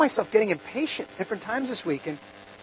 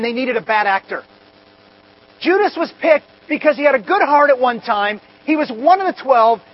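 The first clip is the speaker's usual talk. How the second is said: louder, higher passionate sermon; preaching; 3 semitones higher, 6 dB louder than usual. Acted. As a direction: drive it home like a pulpit climax. Preaching at full force on Judas.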